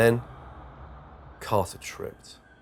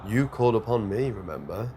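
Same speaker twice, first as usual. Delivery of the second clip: plain reading voice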